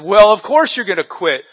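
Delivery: loud, high, fed-up rebuke; exasperated abbot. Acted. Abbot scolding the complaining monk, loud and exasperated. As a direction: snap back like an abbot at the end of his patience. Abbot finally had enough.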